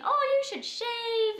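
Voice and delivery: mom's cheery tease, imitated; happy and positive. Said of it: "being so happy about it".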